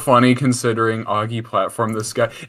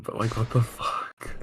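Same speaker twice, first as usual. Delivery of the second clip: whispering; 7 dB quieter than usual. Real, not acted. Whispered aside about the article.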